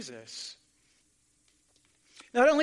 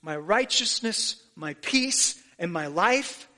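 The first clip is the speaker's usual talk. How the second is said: fervent preaching; 4 semitones higher than usual. Acted. Voicing Jesus, preaching fervently.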